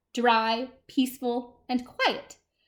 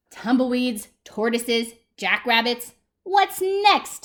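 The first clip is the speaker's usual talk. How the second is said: exasperated; 6 dB louder, slower than usual. Acted. Cactus complaining about visitors, exasperated. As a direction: count off the nuisances, then burst out.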